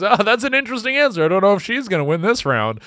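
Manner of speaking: high, fast game show host; fast mock host. Host brushing off a bad answer, fast and high. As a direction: breeze through it like a host. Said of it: "And then he goes".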